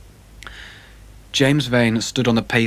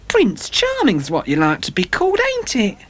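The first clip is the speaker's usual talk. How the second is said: high, taunting call after him; mocking call; 11 semitones higher than usual. A narrator's reading. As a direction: jeer as he walks away. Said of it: "she cried after him".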